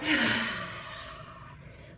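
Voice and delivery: big, audible sighing exhale; open-mouth release. Shown as a demonstration. Teacher releasing a held breath with the class, big sigh. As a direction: let all the held air rush out. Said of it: "And then release, open mouth".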